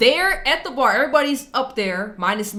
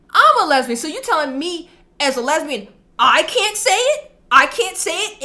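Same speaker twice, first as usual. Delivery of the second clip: incredulous retort; 8 semitones higher, 6 dB louder than usual. Retold or imitated. Coworker retorts to offended boss.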